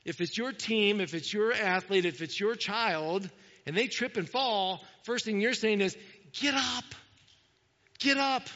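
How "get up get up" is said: mock yell. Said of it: "I would yell that to my sons".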